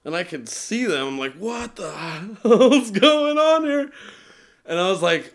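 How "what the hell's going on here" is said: high, loud, drawn-out astonishment breaking into laughter; laughing disbelief. Retold or imitated. Man recalling wings bursting from his back, laughing disbelief. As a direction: laugh through your mock bewilderment. Retold shock at sprouting wings.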